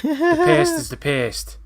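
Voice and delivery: slow, high, hammed-up pompous line; theatrical actor voice. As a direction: overact it like a stage villain. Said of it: "uh, theatrical".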